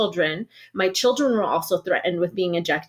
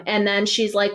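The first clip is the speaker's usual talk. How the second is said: ordinary delivery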